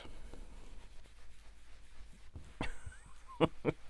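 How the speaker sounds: soft laugh rising high; long breathy giggle at oneself; breathy laughter. Real, not acted. Crafter amused at own muddle, breathy laughter.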